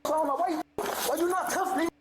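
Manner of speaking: agitated challenge, pitch jumping up; defiant taunting. Real, not acted. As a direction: throw it at them as a dare. Suspect taunting officers during arrest.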